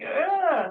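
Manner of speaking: a sudden high ugh of unease; disgusted ugh. Shown as a demonstration. Describing the uneasy throat feeling, a high groan. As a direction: push out a pinched, high groan of discomfort.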